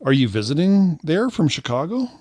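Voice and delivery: playing Diana. Acted text question as Diana.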